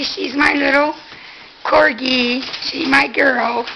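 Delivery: ordinary delivery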